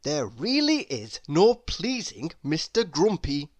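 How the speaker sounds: ordinary delivery, nothing marked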